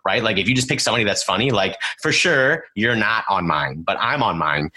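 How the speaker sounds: ordinary talk, nothing marked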